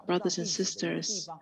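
normal talking voice

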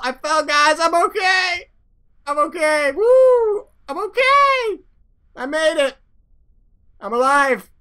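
high-pitched little survivor voice; Jebediah. Acted. Fallen pilot tells the guys he survived, high excited voice. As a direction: squeal it like a tiny astronaut calling to his crew. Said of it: "he's like".

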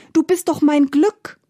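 high, pleading appeal; high-pitched despairing protest; desperate. A narrator's reading. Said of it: "rief der Bauer ganz verzweifelt".

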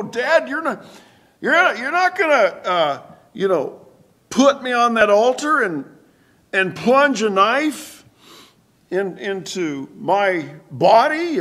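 put-on high, loud voice of a scared boy pleading with his dad; pleading son. Isaac begging his father Abraham not to sacrifice him, high scared plea. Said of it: "have you ever wondered why Isaac did not".

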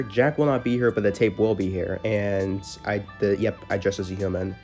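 usual speaking voice

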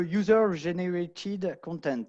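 English words in a French accent; slow attempt at English with a French accent; failed English accent. Real, not acted. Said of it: "j'ai essayé, mais j'ai pas réussi".

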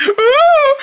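high loud cry; scared scream. Pretend. Frightened villager screaming at the noise.

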